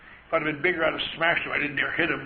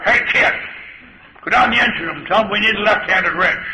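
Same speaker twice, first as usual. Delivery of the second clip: put-on loud, higher teasing call to the kid; sailor calling out; 4 semitones higher, 10 dB louder than usual. Old sailor sending the new kid off, loud mocking call. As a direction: bark the fool's errand across the deck.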